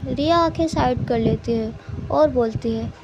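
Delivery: plain reading voice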